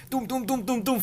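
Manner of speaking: high, punchy mouth-made thuds; playful imitated punches; punch sound effects. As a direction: beat out the hits with your voice. Punching friend's back.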